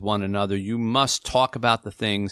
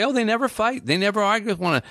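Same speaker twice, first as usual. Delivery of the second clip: much higher-pitched, quick imitation of onlookers; gossiping onlookers; 11 semitones higher, much faster than usual. Outsiders remarking on a couple who seem perfect, high imitated chatter.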